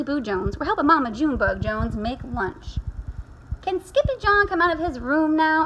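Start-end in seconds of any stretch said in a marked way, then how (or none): 3.6-5.6 higher kitten-sister voice; little sister asking. Acted. Sister asking mother to free her brother, asking. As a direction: plead lightly, pitched up. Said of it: "asked Jujubee".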